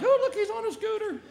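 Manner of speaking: put-on a girl pointing and laughing at him; high mocking girl voice.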